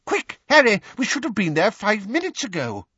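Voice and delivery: high, loud urgent call; panicked urgency. Acted. Weasley hurrying Harry to the hearing, panicked. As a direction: rush him out in a panic.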